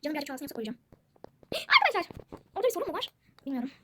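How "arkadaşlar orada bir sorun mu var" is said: sharp gasp, then a high, loud cry of arkadaşlar; startled gasp. Real, not acted. Gamer startled by a problem in the game, gasping cry. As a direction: jolt in alarm and shout it out.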